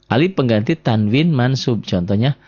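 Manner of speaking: normal talking voice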